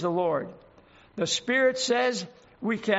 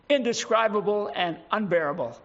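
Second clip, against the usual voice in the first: ordinary talk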